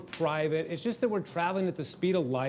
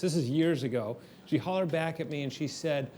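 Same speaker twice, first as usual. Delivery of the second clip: ordinary talk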